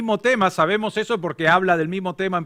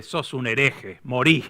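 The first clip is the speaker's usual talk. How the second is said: lower, softer deadpan condemnation; cold verdict; 5 semitones lower, 4 dB quieter, slower than usual. Acted. Rescuer condemning the jumper as a heretic, deadpan. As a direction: pass sentence flatly and coldly. Punchline after awkward pause.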